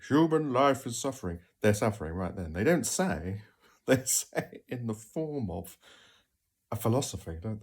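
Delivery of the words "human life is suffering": loud, higher pompous pronouncement; a hint of a pompous philosopher; mock philosophizing. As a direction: intone it like a weary sage. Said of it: "heard someone go".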